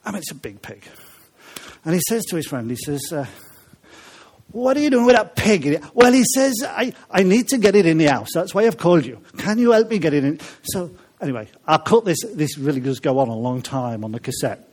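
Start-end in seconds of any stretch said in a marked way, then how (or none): 4.5-10.4 put-on Welsh accent. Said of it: "with a Welsh accent".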